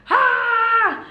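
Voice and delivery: short high-pitched mock scream; high "ah!" of a startled child; imitated scream. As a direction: mimic frightened kids shrieking.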